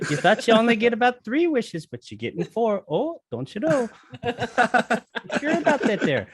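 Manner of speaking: sing-song Midwest genie teasing; northern Wisconsin accent with a laugh. Retold or imitated. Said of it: "Francis McDormand is a genie".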